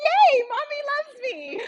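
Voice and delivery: very high, slow delighted pet voice; happy dog voice. Acted. Dog answering mommy in a happy, high-pitched voice. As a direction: squeal it slowly like a thrilled dog. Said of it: "And he's like".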